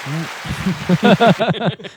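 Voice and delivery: high squeaky dolphin chirps; chirpy clicking dolphin. Pretend. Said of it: "that impression of a dolphin".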